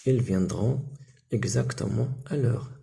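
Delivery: slowed, careful model answer; slow model sentence. Shown as a demonstration. Teacher models the answer for pupils. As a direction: lay out each word for the class. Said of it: "واش نقولوا؟".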